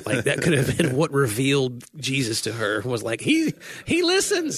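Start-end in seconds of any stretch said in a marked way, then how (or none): 3.2-4.6 high, slow reverent voice; a hushed, high awestruck quote. Retold or imitated. Voicing Sadie's revelation.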